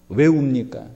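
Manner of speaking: usual speaking voice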